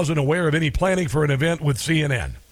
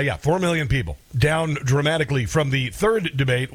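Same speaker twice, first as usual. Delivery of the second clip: usual speaking voice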